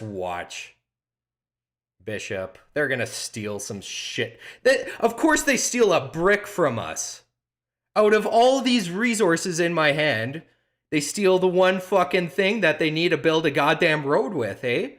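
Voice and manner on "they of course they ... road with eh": high, indignant rant; heated, raised-pitch complaint; exasperated outrage. Real, not acted. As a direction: rant at being robbed in the game. Opponent steals the needed brick.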